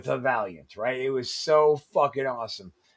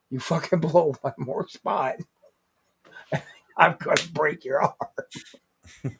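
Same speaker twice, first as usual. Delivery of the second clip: threat broken up by chuckles; laughing threat. Retold or imitated. Veteran's warning retold to podcast friends, laughing threat. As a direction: laugh through the warning, slowing at the end.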